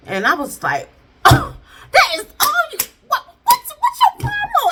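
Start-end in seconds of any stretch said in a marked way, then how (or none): 1.2-4.7 very high, loud mock outrage; outraged disbelief. Viewer confronting husband over a cheek peck, outraged. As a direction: squeal it loudly in stunned indignation.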